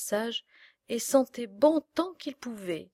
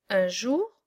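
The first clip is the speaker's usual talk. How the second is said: ordinary delivery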